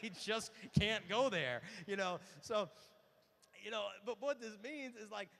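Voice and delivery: usual speaking voice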